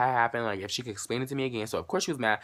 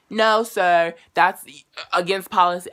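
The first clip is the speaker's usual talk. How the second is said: a condescending clerk, louder and higher; mock rude clerk; 7 semitones higher, 9 dB louder than usual. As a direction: snootily quote the bank lady's refusal. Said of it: "getting rude as shit".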